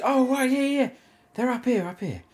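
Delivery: high voice mocking the shop worker; mimicking the shop worker. Mocking the shop worker in a high voice. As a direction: pitch up as the clueless shop worker.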